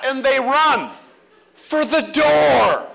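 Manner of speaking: normal talking voice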